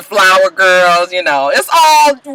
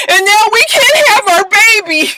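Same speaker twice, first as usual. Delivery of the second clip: mock crying; 12 semitones higher, 3 dB louder than usual. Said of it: "all this crying".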